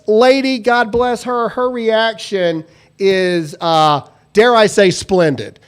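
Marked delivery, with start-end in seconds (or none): none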